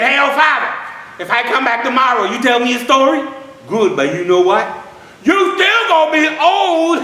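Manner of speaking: put-on high, loud, laughing child; teasing little boy. Little boy laughing that old father will stay old, high and giggly. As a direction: giggle it out like a cheeky kid.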